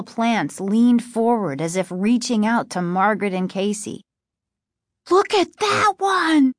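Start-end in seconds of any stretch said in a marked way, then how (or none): 5.1-6.5 put-on high-pitched frightened boy; shrill-pitched startled exclamation; alarmed cry. A narrator's reading. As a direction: cry out, recoiling from the sight. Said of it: "Casey cried".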